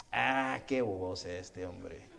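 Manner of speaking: low, soft, very slow mock lament; drawn-out dismay. Landlord despairs of tenant leaving to serve God, drawn-out dismay. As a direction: stretch the "Ah" and "qué" in disbelief.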